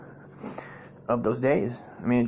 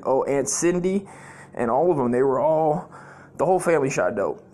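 ordinary talk, nothing marked

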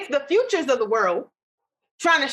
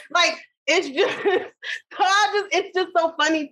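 laughing through words; 5 semitones higher, slower than usual. Real, not acted. Cracks up mid-sentence.

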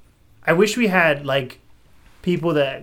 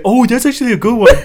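mimicking Gabby; 7 semitones higher, 6 dB louder than usual. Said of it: "And she said".

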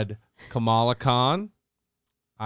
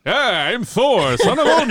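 mock Thor; 9 semitones higher, 4 dB louder than usual. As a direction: puff up as a clueless smug god. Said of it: "Thor's just like".

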